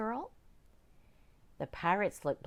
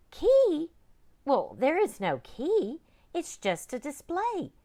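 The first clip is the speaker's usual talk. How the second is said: high, bright surprised librarian; high, startled lady librarian; 11 semitones higher, 8 dB louder than usual. Acted. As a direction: raise the voice high, puzzled and polite. Librarian voiced by narrator.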